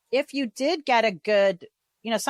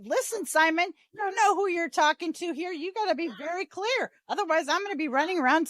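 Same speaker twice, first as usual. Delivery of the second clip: higher-pitched mock-indignant pleading; playful protest; 8 semitones higher than usual. Real, not acted. Host teasing her guest Simon, high mock indignation. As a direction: plead for clearer rules, half joking. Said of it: "joking protest at Simon".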